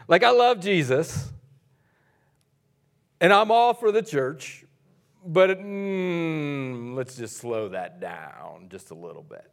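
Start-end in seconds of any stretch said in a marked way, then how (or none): 5.2-9.5 much lower, much softer hesitant voice; mock the hesitant holdout; reluctant inner voice.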